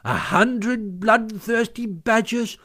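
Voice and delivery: high, slow, emphatic warning; Mole as washerwoman. A narrator's reading. Washerwoman warns the sentries of attack, portentous. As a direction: spin out the threat with relish.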